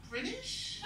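very high rising question; tentative date's guess. Acted. Date guesses comedian is British, high tentative question. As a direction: imitate a hesitant guess, pitched right up.

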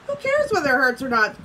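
ordinary delivery, nothing marked

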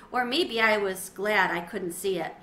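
ordinary delivery, nothing marked

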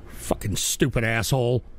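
soft, deep, disgusted aside; low contemptuous muttering. Real, not acted. Host cursing the donor after mocking him, low contempt. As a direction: drop low and quiet in disgust.

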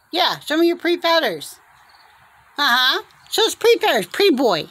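high, eager urging; coaxing pet talk. Real, not acted. Owner urging bird to show pretty feathers, high coaxing. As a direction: urge the bird on in a lifted voice.

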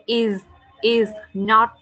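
2 "is" said slowly and clearly, with a firm final s sound; 3 draw out "is", and make sure the s is heard; 1 slow careful "is". Shown as a demonstration. 4 teacher correcting a student's "it" to "is", slowly and clearly. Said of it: "you have to say".

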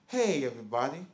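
low, soft American greeting; mock American accent, pitched well down; put-on American accent. Shown as a demonstration. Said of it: "Is that your American".

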